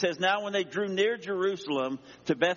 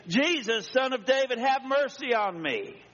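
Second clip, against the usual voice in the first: crying out; 10 semitones higher than usual. Acted. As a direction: call out urgently, pitch well up. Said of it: "he began to cry out and say".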